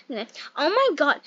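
ordinary talk

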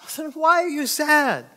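high, loud, drawn-out question; acted bafflement, stretched out; exasperated friend.

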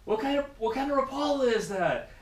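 high, loud nosy question; mock curious stranger. Float tubers asking which lure he uses, high and eager. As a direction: squeak out the question like an eager onlooker.

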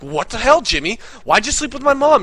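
high, loud indignant questioning; mock outrage. Gamer confronting Jimmy about his mom, loudly outraged. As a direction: protest loudly, hammed up.

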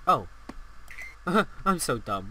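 ordinary delivery

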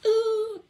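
a high, delighted ooh. Real, not acted. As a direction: coo at the new mirror. Admiring her new mirror.

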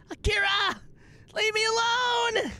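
high-pitched dramatic shout; exasperated yell. Pretend. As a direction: yell it high, begging to be left alone. Yells at someone tormenting them.

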